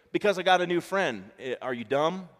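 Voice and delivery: a touch of schoolgirl scorn; mock mean girl. Schoolgirl brushing off her former friend, mock mean. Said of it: "girls are doggone mean".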